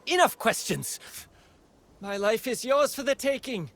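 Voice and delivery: high-pitched, heated defiance; agitated outburst. Acted. Swordswoman refusing further questions from the interrogator, agitated. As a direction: cut off the questioning, voice jumping up. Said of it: "Calm down".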